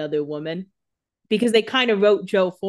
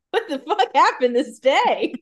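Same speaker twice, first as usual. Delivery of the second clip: slow, higher, disbelieving mock read; mock baffled reader, drawn out and higher; baffled Elena; 9 semitones higher, 5 dB louder, slower than usual. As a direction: sound utterly confused by the diary. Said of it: "Elena's reading this like".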